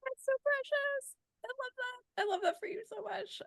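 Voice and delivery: very high, soft cooing; touched, tender. Real, not acted. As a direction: coo with heartfelt tenderness. Host touched by story.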